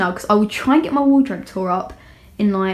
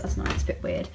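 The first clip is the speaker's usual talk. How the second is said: embarrassed whisper; 10 dB quieter, faster than usual. Real, not acted. Embarrassed about showing underwear.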